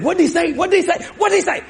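eager disciple pestering; anxious repeated question. Acted. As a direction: nag for the answer, rising each time. John asking Peter anxiously.

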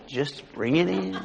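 hushed and unhurried, savouring the words; soft, slow repeat. Retold or imitated. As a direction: let it sink away slowly and quietly. Retold order repeated, trailing softly.

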